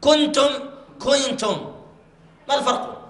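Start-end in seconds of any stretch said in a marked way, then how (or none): 0.0-1.8 كنتم with the nun nearly pronounced; wrong, nun almost shown. Shown as a demonstration. Teacher mocking the common slip for the class. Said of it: "تكاد النون تظهر".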